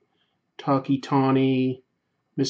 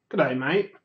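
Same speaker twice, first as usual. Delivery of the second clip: lightly put-on Aussie accent with a rising end; Australian accent. Streamer trying the greeting once more for viewers, Australian accent. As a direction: have a modest go at an Australian accent. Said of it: "How, how was that accent?".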